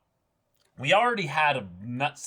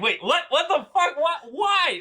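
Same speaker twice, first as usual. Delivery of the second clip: much higher, loud shocked shriek with a laugh; shocked disbelief; 7 dB louder than usual. Retold or imitated. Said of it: "It's like".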